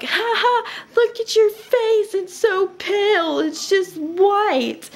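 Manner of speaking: high mocking taunt, slow at the end; mocking ghost. Teasing a pale ghost in a high mocking voice. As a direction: tease the ghost in a squeaky high voice. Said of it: "I'd be like".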